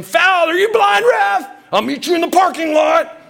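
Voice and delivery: high, loud hammed-up yelling; angry sports parent. Parent at church basketball disputing a foul, shouting angrily. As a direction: holler furiously at the ref from the stands.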